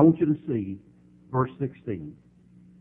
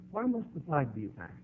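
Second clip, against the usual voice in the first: normal talking voice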